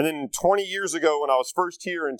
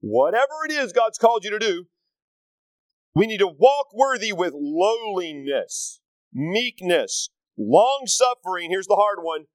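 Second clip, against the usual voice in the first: preaching; 4 semitones higher than usual. Acted. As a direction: drive the scripture home. Preaching scripture with force.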